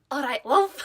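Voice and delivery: put-on Scouse accent through laughter; Liverpool accent. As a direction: say it like a Liverpool local. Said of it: "I'm gonna try and do a Liverpool accent here".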